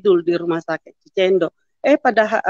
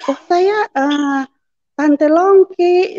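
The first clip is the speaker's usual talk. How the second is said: higher, drawn-out exclamation of recognition; retold delighted greeting, pitched up and slowed on the name; excited recognition; 8 semitones higher, 4 dB louder, much slower than usual. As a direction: call out the name high and long. Recognizing family friend's child, retold.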